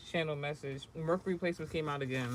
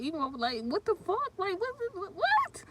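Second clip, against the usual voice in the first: incredulous build ending in a squeal; rising disbelief. Real, not acted. Answering viewers who ask if she is a man, disbelief rising to a squeak. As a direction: climb higher and higher until the last what squeaks. Outraged at a question.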